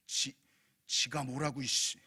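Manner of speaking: low, offended grumble; mock huffy muttering; muttered resentment. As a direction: imitate cursing someone under your breath. Offended churchgoer grumbles back.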